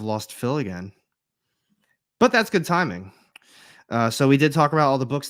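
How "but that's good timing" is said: ordinary talk, nothing marked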